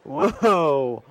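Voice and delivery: loud startled whoa; mock surprise. Man teasing her about "I do", mock surprise. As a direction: jump on her words.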